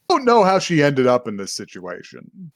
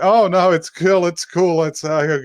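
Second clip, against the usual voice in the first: flustered brush-off; 6 semitones higher, 4 dB louder than usual. Retold or imitated. Boy awkwardly brushes off tattoo question.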